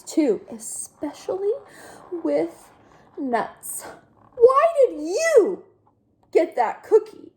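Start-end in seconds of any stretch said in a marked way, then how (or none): 4.3-7.3 demanding pigeon. Acted. Pigeon demands why duckling got the cookie, high. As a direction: insist on knowing.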